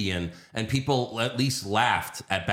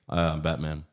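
lower, casual mock Batman; lazy Clooney Batman; 6 semitones lower than usual. Mocking Clooney's Batman for friends, low and offhand. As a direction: toss it off like a bored star. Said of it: "but then we had just George Clooney".

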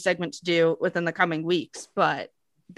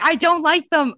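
higher and louder, insisting hard on don't; emphatic protest; 9 semitones higher, 6 dB louder than usual. Real, not acted. To shocked co-host about mangoes, loud emphatic protest. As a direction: defend yourself loudly with a hit on don't.

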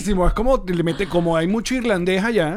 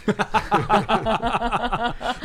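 hearty laugh; 5 semitones higher than usual. Real, not acted. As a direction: burst of laughter at a joke. Joke about softball and beer.

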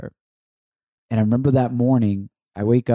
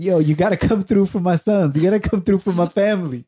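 higher, insistent hype-man plea; urgent cheering-on, pitched up; 7 semitones higher, 4 dB louder than usual. Retold or imitated. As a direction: imitate Dad rooting hard for the family. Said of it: "like my hype man up there".

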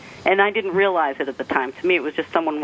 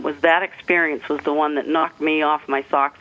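normal talking voice

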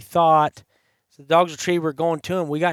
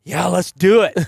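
pumped-up; 4 semitones higher, 7 dB louder than usual. Retold or imitated. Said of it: "so much adrenaline running through him".

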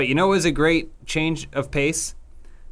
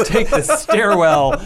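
laughing; 5 semitones higher, 4 dB louder than usual. Real, not acted. Laughing retelling of plan.

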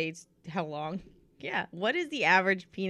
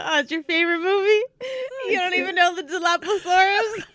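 high, louder indignant mock quote; mocking a friend, higher; 10 semitones higher, 4 dB louder than usual. Mimicking her friend's rant about the dinosaur, high indignant mock voice. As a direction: squeal it like an outraged fan. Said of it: "The best one you go".

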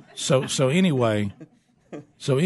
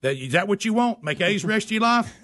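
much higher, hammed-up scolding dad; mock outrage; 8 semitones higher than usual. Dad ranting at son about bad grades, hammed-up high outrage. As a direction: ham up a dad's dramatic rant.

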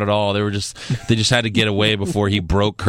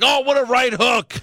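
higher, mock-amazed; gushing commentator; 12 semitones higher than usual. Co-host mimics Corey Graves praising the punch, mock amazed. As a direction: fake awe over a basic hook. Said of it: "he was like".

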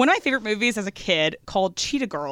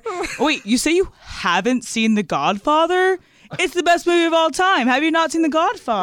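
IPA guy impression; 7 semitones higher than usual. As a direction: gush like a craft-beer bro. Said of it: "that's my I P A guy impression".

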